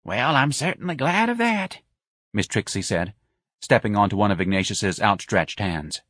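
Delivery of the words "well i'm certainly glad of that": put-on high, dotty old lady; feeble old woman. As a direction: express relief in a high feeble voice. Feeble Miss Trixie voiced.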